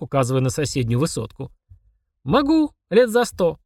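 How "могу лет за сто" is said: put-on high, slow girlish voice; little girl working it out; pondering child. A narrator's reading. Girl considering the demon's challenge, slow high pondering. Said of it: "задумалась Полина".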